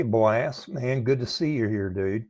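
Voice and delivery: ordinary talk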